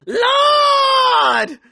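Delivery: high wailing cry of despair; anguished cry. Acted.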